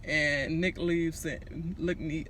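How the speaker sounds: ordinary talk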